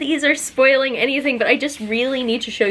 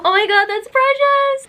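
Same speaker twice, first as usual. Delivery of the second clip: high, loud, excited gushing; 4 dB louder than usual. Real, not acted. Showing the couple art to viewers, a loud high gush. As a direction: burst out in delight.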